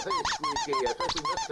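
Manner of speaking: squeaky voice. Acted. Greeting a puppet character.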